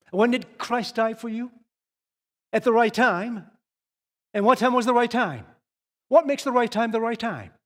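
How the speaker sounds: rising pulpit intensity; fiery preaching. Acted. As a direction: preach it to the back row. Preaching at full force.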